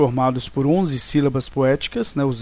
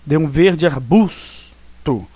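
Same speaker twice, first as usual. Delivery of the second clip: slow, "verde" leaned on, "De um" run together; slow scansion, "De um" joined as one poetic syllable; stressed scansion; much slower than usual. Shown as a demonstration. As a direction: drag the phrase out, landing on "verde". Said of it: "Então aqui é a nona, observem".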